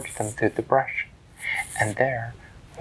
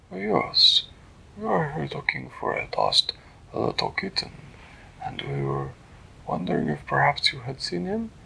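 higher, formal and polite small hero; Flippertigibbet voice; 5 semitones higher than usual. Acted. Flippertigibbet greeting the turtle, high and polite.

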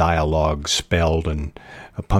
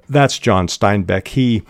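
ordinary delivery

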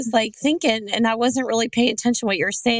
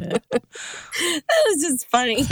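laughing; 4 semitones higher, much slower than usual. Real, not acted. Laughing at the joke.

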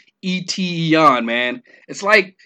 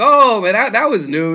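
mock amazement; 6 semitones higher, 5 dB louder than usual. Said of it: "There's nothing like".

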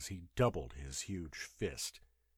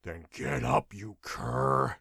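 low, growled roar; deep, brutal bully voice; roaring guardsman; 5 semitones lower, 4 dB louder, slower than usual. A narrator's reading. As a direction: roar it deep at a cringing prisoner. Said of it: "roared Posthumo".

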